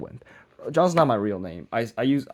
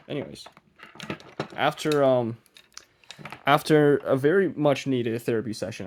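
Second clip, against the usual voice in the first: usual speaking voice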